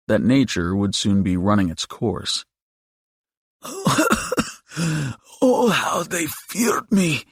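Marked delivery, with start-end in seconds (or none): 3.6-4.5 very high, wheezy monster laugh; high-pitched creature cackle; demon's laugh. A narrator's reading. 5.4-7.3 high, slow, croaky monster voice; drawn-out old creature's croak; croaking demon. A narrator's reading. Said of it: "Diavo croaked".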